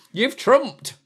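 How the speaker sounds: high, loud playful outrage; mock shock. Real, not acted. Dad catching his baby trumping, high mock shock.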